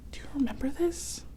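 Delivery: gasp, then whisper; hushed thrilled question; excited whisper. Acted.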